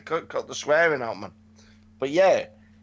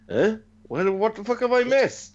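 rising, higher-pitched bewilderment; retold confusion; 6 semitones higher than usual. Host retelling his reaction to missed events, mock confusion. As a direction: act out being baffled, pitched up. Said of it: "I was just like".

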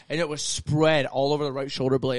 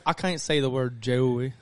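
slow, lower Southern country drawl; country accent; 4 semitones lower, slower than usual. Retold or imitated. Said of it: "I was talking in a country accent".

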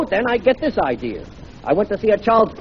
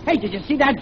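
loud, high Brooklyn yelling at a driver; Brooklyn accent; 9 semitones higher, 3 dB louder than usual. Acted. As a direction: yell it in thick Brooklyn. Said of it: "talking with a Brooklyn accent".

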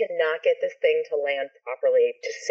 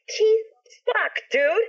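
much higher-pitched, exasperated yell; exasperated call; much slower than usual. Retold or imitated. Sister calling to brother about the noisy helicopter, exasperated high yell. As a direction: act out yelling at an annoying brother.

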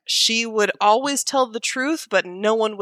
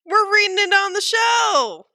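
high excited announcement; excited echo; 12 semitones higher than usual. Real, not acted. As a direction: announce it with glee.

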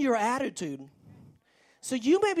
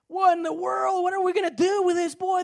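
baffled parents; 8 semitones higher, 5 dB louder than usual. Acted.

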